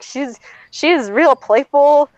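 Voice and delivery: high, stretched-out unconvincing assurance; overeager fibbing. Retold or imitated. Lying to parents about sick puppy.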